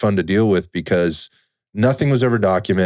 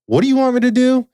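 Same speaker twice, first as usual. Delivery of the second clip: high, louder disbelieving question; pitched-up bewildered plea; incredulous question; 4 dB louder than usual. Retold or imitated. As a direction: demand it in baffled disbelief. Retelling confused reaction to scammer.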